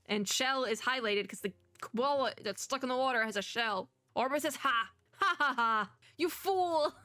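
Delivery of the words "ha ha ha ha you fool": hammed-up villainous cackle and taunt; mocking villain. Orbit scorning the bigger shell idea, mocking villain. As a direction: laugh in scorn, then pitch the taunt high. Orbit mocks the frog's suggestion.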